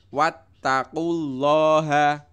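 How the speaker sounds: teacher demonstrating a doubled letter; stressed t. Shown as a demonstration. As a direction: press the tt in wattaqullaha. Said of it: "Taknya ditekan".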